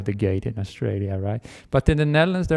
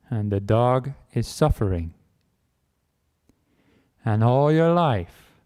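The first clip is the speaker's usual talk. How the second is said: ordinary delivery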